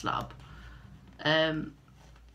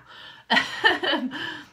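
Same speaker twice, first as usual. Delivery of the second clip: high giggle; 9 semitones higher, 5 dB louder than usual. Real, not acted. Amused at herself.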